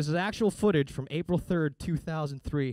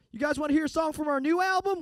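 rocker hyping the crowd; 13 semitones higher, 5 dB louder than usual. Retold or imitated. Band greeting fans at a concert, hammed-up rock frontman. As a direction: shout it to a stadium crowd. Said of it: "they're like".

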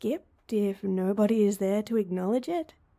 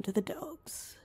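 fading whisper; 2 semitones higher, 9 dB quieter than usual. Acted. Trailing off bitterly to herself.

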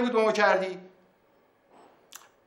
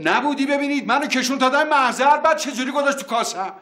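high-pitched, aggrieved storytelling; worked-up uncle ranting about the notary; indignant retelling; 8 semitones higher than usual. Real, not acted. Uncle recounts being tricked, indignant.

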